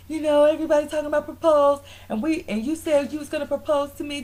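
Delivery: high-pitched, whiny imitation of griping; mock complaining. Retelling Kenya's complaint to Walter, aggrieved. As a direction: imitate a girlfriend airing her grievance.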